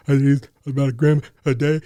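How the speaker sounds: badly faked sobbing while getting the words out; imitated crying. Answering the detox questions through imitated crying. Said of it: "I can't stop crying".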